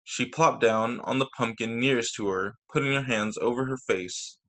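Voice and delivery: plain reading voice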